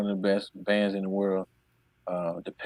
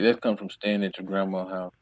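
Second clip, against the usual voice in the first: ordinary talk, nothing marked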